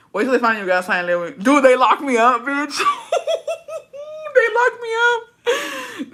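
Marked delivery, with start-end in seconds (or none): 1.4-6.1 high cackle through the words; helpless laughter, squealing the words; laughing hard. Real, not acted. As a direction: crack up while saying it, voice shooting up. Cackling at being locked up.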